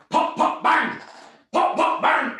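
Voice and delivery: shouted pop pop bang gunfire noises; mock gunshots. Mocking a shootout to his co-host, shouted gunfire noises. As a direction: blurt out gunshot sounds, loud.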